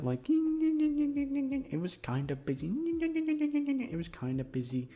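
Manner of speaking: high, slow, drawn-out goofy voice; silly high voice. Pretend. To the listeners about work, goofy high slow voice. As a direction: stretch it up into a silly squeak.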